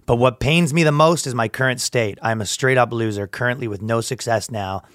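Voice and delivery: low, soft confessional read; mock-solemn post reading. Reading a stranger's confession to the co-host, low and solemn. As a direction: read it out gravely, voice dropped. Reads a sad online post.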